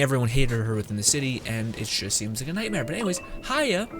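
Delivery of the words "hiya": ordinary talk, nothing marked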